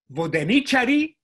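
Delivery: Vodenichari, stress moved onto NI; loud, demonstrative vodeNIchari; antepenultimate stress. Shown as a demonstration. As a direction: punch the NI in Vodenichari, high and loud. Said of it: "That's how people talk".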